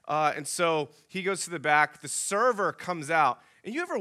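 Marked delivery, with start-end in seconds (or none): none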